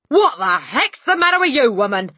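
loud, high-pitched irritated shout; angry shout. A narrator's reading. Father to startled wife, angry shout. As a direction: bellow it at your wife. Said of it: "he shouted".

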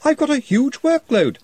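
flustered protest. Acted. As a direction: panic about the workload. Ponder protests to Ridcully.

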